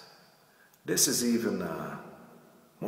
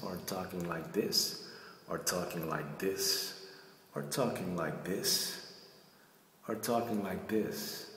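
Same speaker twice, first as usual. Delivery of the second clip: softer, lower run of the same phrase; softer demo takes; 3 semitones lower, 7 dB quieter than usual. Shown as a demonstration. Coach shows variations to camera, softer. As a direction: drop the voice and let the look change. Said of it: "try out a few things in the mirror".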